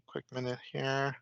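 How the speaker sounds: usual speaking voice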